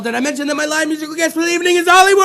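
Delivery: rushed build into a held, high last name; announcer introduction. Real, not acted. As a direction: rattle it off, then stretch the name out rising. Host introduces musical guest again.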